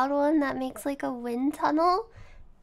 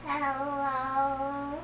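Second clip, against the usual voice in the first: alien greeting, softer, through the fan; calm breathy gray alien; 5 dB quieter than usual. Pretend. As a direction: murmur hello like an alien. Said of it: "do an alien voice into the fan".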